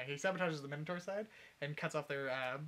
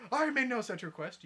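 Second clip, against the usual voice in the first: indignant retort; 9 semitones higher, 8 dB louder than usual. Acted. Ruler's retort in acted quarrel.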